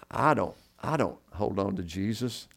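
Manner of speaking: ordinary delivery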